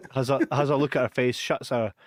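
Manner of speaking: normal talking voice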